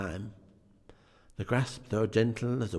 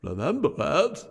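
higher, louder eager cry; feverish cry; 7 semitones higher, 6 dB louder than usual. A narrator's reading. Scrooge to the ghost, crying out with fever. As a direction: burst out eagerly. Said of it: "cried Scrooge with a fever".

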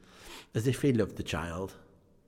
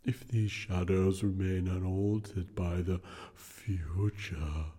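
unearthly voice; slower than usual. Acted. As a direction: intone it like a solemn spirit. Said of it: "replied the ghost".

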